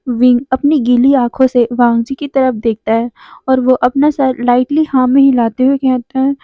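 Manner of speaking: plain reading voice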